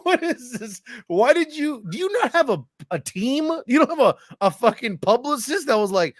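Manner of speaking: squeaky high mock disbelief, laughing through it; incredulous mock rant, laughing. Mocking the player's podcast.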